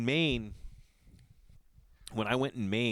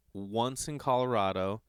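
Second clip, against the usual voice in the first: ordinary talk, nothing marked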